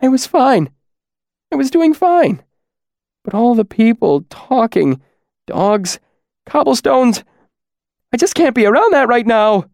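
a fragile man's voice cracking, close to breaking. Acted. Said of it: "his voice cracking a little".

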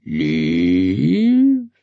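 long drawn-out word, sliding up into a question; mocking drawl. Scarecrow echoing the girl's word, mocking drawl. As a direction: stretch it out, half surprised, half mocking. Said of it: "drawling the sound in either surprise or mockery".

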